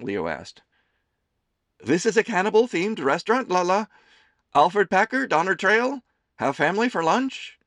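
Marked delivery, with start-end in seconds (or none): 1.8-7.6 high, loud doting mother voice; a fussing mother teasing her son; Amelie's voice. A narrator's reading. Mother serving her son lunch, high and fussing.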